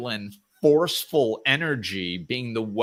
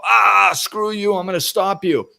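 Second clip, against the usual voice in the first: loud, high, hammed-up snarl; angry rock person; 9 semitones higher, 9 dB louder than usual. Angry person blocking someone's way, loud mock snarl. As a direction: bark it like a bully blocking the road. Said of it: "they come up and they go".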